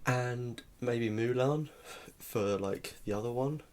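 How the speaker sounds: normal talking voice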